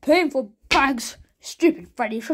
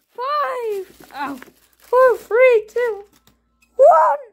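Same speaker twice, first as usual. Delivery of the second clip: excited countdown; 10 semitones higher, 6 dB louder, much slower than usual. Acted. Countdown to reveal.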